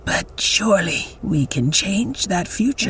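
slow whispered hope; hushed plea. Acted.

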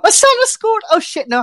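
high, bursting goal cry; excited. Retold or imitated. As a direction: jump up in pitch like the goal just went in. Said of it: "but I'm".

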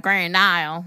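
slow, drawn-out Louisiana twang; Southern twang. Shown as a demonstration. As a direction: stretch the town name with a Southern twang. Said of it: "that accent".

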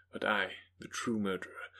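ordinary delivery, nothing marked

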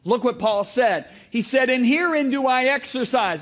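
loud, slow sermon read; full-force preacher voice; preaching. Acted. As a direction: preach it out to the crowd. Preacher reading scripture at full force.

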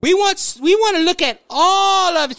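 mock politician in a high Southern voice; Southern accent. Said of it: "I'm using my Southern accent".

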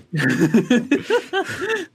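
high-pitched, drawn-out laughing fit; helpless high laugh at the joke; long laugh. Real, not acted.

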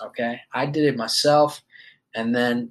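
normal talking voice

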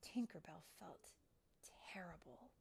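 plain reading voice

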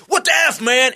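high, loud, outraged mock yell; mock shout. Bumped man yelling at drunk, mock shout. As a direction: bark it as if shoved.

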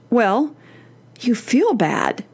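usual speaking voice

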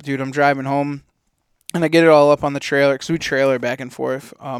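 ordinary delivery

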